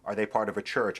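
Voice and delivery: usual speaking voice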